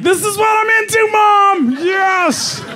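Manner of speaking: high shouted proud dog voice. Retold or imitated. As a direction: announce it proudly like a dog coming out. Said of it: "The dog's like".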